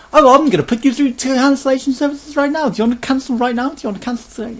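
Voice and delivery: high, loud, fast chirpy sales voice; mock an overeager phone agent; cheery pushy salesperson. Mocking Sky agents pushing him to cancel, cheery high salesperson voice. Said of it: "Cheery, happy".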